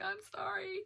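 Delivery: very high, small meek voice; squeaky sorry. Retold or imitated. Patient apologising to staff, very high and meek. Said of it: "And I was like".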